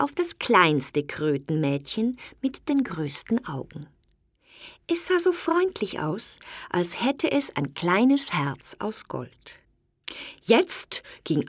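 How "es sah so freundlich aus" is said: ordinary delivery, nothing marked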